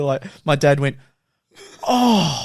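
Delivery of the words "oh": high, pained gasp of shock; mock horrified dad. Dad catching son on camera, high pained oh.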